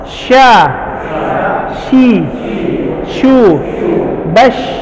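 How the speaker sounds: Sya, shi, shu, bash with long airy sh; breathy sh drill. Shown as a demonstration. Teacher drilling a breathy sh for the class. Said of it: "nafas yang kebuang".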